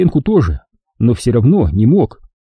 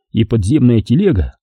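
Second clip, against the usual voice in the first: ordinary delivery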